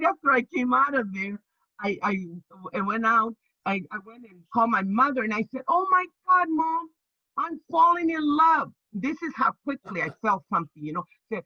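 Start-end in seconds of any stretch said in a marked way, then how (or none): 5.7-8.7 giddy excitement. Retold or imitated. Daughter telling her mother she's falling in love, giddy and high. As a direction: squeal it like a smitten girl.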